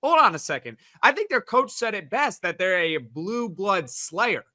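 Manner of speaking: usual speaking voice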